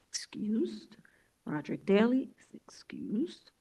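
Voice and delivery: soft unvoiced whisper; hushed whisper to a colleague; whispered aside. Real, not acted. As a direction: whisper it so the room can't hear. Quiet aside during roll call.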